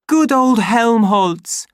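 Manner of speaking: high, loud and slow; joyful greeting shout; glad shout. Acted. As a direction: hail a friend in the thick of a brawl. Savage greets ally mid-fight.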